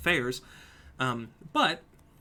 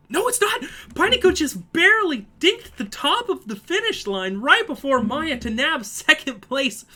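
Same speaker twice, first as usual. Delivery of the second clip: much higher and louder, surprised call; excited shout; 12 semitones higher, 10 dB louder than usual. Real, not acted. Commentator calling a last-second overtake at the finish, shouted. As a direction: yell the upset at the line.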